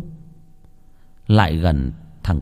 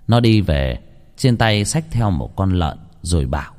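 ordinary delivery

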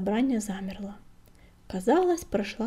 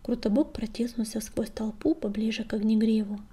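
ordinary delivery, nothing marked

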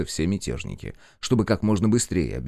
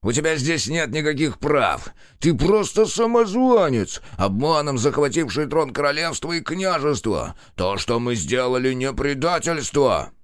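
злой крик; 5 semitones higher than usual. A narrator's reading. Раненый барон кричит на короля.

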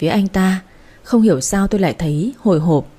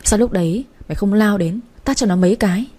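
ordinary delivery

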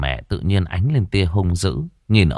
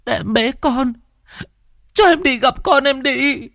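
desperate pleading. Acted. Mother begging her husband at the ferry, frantic wail. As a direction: wail it out, trying to reach her dead child.

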